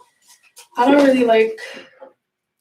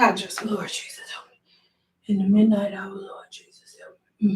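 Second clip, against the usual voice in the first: soft, whispered plea under the breath; whispered prayer; 2 semitones lower, 5 dB quieter than usual. Real, not acted. Worn down by viewers' questions, whispered prayer. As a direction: whisper a plea for help to yourself. Muttered plea for patience to herself.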